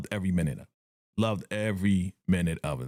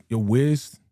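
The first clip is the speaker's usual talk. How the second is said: higher and louder, slow searching "where's the watch"; questioning girlfriend; 7 semitones higher, 4 dB louder, much slower than usual. Retold or imitated. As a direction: act out the puzzled girlfriend hunting for the watch. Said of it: "she was like".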